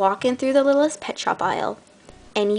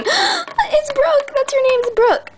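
very high, louder, near-crying rapture; tearful joy; 3 dB louder, slower than usual. Pretend. Owner finally names her new pet, tearfully overjoyed. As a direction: overcome with happy tears. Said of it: "And you say".

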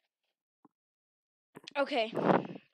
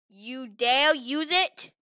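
slow indignant challenge; 10 dB louder, much slower than usual. Acted. Defying the warning.

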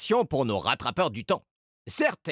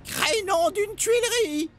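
very high, slow strangled oath; choking oath. A narrator's reading. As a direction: choke out the curse in shock. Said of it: "s'étrangla Edgar".

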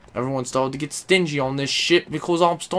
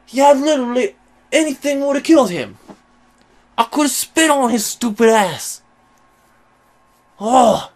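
loud, high rage at the game; screaming curses at the screen, much louder; furious yelling; 5 semitones higher, 5 dB louder than usual. Real, not acted. Gamer furious at a near-kill, yelling. Raging at a near-miss death.

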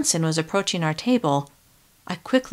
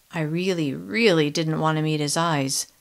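usual speaking voice

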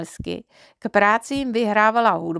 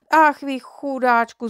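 a touch of pity, higher and louder, slowed; mild mock lament, slowed and lifted; pitying cry; 5 semitones higher, 4 dB louder than usual. A narrator's reading. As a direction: sigh out her sympathy for the poor boy. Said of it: "zvolala Čičinka".

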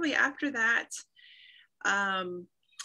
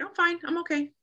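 light, higher, dismissive brush-off; 8 semitones higher than usual. Retold or imitated. Brushing off her doctor, a mock-cheerful higher "I'm fine". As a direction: wave off the concern, pretending to be fine.

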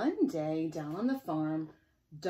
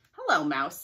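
loud hello rising at the end; 11 dB louder than usual. Acted. Duck riding no hands calling to the mouse, loud. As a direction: call out brightly to the mouse.

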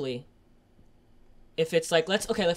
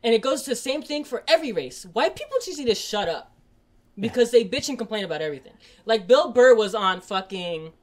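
higher and louder, driving on; still fired up; 5 semitones higher, 5 dB louder than usual. Real, not acted. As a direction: keep the heat in the rant. Rant continues, still high.

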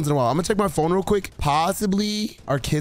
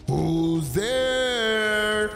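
calling out; 6 semitones higher than usual. Pretend. Kidnapper calls out for intruders.

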